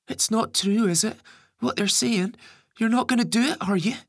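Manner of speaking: put-on very high, loud child's voice; distressed young boy. A narrator's reading. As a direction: voice an upset kid, high-pitched and urgent. Narrator voices the boy Ben.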